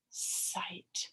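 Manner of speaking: "Sight" whispered very softly. Shown as a demonstration. Teacher repeating sight as a whisper. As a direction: breathe the word sight.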